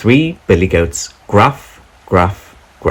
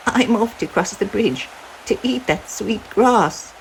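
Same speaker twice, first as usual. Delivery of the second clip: a tiny goat setting off bravely; littlest billy goat; 14 semitones higher than usual. Acted. Littlest goat announcing he will cross, high and small.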